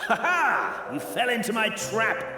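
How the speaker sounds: high, triumphant gloat; gloating villain. Acted. Villain to trapped pursuer, gloating high. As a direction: crow it high over the caught pursuer.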